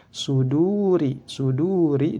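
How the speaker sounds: "Suduri" with a long du; slowly recited "Suduri", middle vowel held two beats; lengthened vowel. Shown as a demonstration. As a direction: stretch the second u in "Suduri". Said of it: "dibaca panjang".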